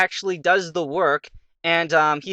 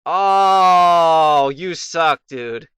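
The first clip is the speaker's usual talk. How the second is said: long loud groaned "oh", then complaint; real frustration, starting loud and falling away; exasperated protest; 7 dB louder, much slower than usual. Real, not acted. Player grumbles at the rival in battle, exasperated. Opponent outplays the player in game.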